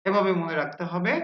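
usual speaking voice

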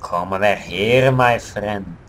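loud beckoning to a supposed friend; playful calling out. Pretend. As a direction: call the enemy over, good and loud. Luring an enemy player over in play.

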